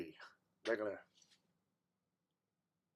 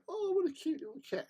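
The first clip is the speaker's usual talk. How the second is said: very high cutesy coo; cooing at a cat. Retold or imitated. Someone coos at the dangerous cat, very high. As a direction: gush over a cute kitty. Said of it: "and go".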